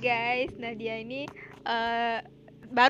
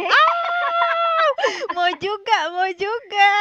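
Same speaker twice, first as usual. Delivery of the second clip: very high, loud squeal easing into a higher, louder repeat; excited squeal; 9 semitones higher, 8 dB louder, much slower than usual. Real, not acted. Host hears the couple met through Instagram DMs, excited squeal. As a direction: squeal with envy and delight. Squealing after hearing IG DM romance.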